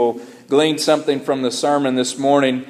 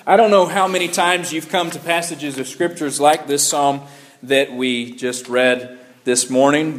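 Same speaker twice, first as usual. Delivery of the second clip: ordinary talk, nothing marked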